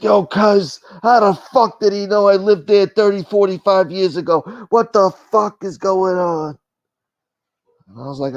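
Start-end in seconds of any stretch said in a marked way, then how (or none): 0.0-6.6 higher, louder panicked mock New Yorker; imitating Rob G. Rob G panicking about being found, mock alarm. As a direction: freak out like a spooked guy from the block. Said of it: "He's like".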